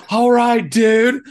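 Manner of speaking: high-pitched, hammed-up surfer dude. Host introducing his surfing co-host, surfer dude voice. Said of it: "I can't do the surfer accent".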